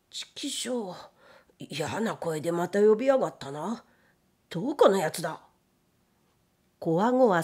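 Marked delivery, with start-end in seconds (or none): 0.1-5.4 jittery grumble, whispered curse to start; spooked annoyance. A narrator's reading. Gatekeeper spooked by a voice in the night, uneasy grumbling. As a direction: curse under your breath, then grumble uneasily.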